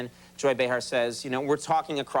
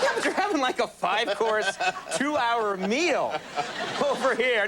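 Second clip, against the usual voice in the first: laughing disbelief; 8 semitones higher than usual. Retold or imitated. Retold disbelief at the diner.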